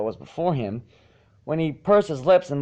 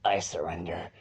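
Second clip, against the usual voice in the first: low, soft, beaten moan; defeated moan; 7 semitones lower, 8 dB quieter than usual. A narrator's reading. Exhausted Spike moans surrender after training, soft and low. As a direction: groan it out, totally spent. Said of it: "and moaned".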